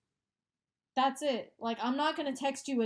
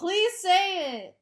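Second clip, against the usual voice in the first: eager plea; 7 semitones higher, 8 dB louder, slower than usual. Real, not acted. Friend begging for gossip.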